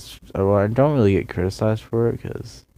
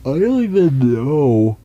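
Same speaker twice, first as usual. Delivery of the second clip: yawning while talking; 5 semitones higher, 6 dB louder than usual. Real, not acted. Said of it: "Sorry for my yawning".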